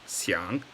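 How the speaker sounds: "xiang" in a low, dipping third tone. Shown as a demonstration. Teacher showing learners the first character, "xiang" in third tone. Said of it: "it's with the third tone".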